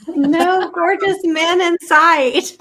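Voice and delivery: high, loud, drawn-out tease; teasing through laughter. Real, not acted. Co-host teasing friend about the pool, laughing. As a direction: laugh while you rib your friend.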